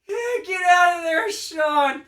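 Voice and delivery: high, loud call to rescue; urgent shout. Retold or imitated. Parent finally finds son in a glass cage, urgent shout. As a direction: shout as a mock-frantic parent.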